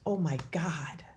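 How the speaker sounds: whispered aside; hushed whisper to yourself. Acted. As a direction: breathe it as a secret. Aside about claiming what's wrong.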